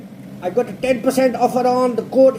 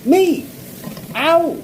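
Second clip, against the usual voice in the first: cat voice; 5 semitones higher than usual. Retold or imitated. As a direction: squeak it like a cat going meow. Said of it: "And the cat says".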